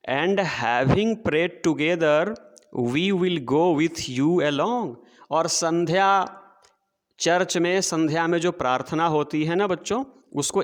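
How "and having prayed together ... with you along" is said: very slow, measured verse reading; poem recitation. Shown as a demonstration. Teacher reading poem to children, slow recitation. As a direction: read the line out word by word for the class.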